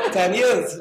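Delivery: laughing at own joke. Real, not acted. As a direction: still laughing as you get the words out.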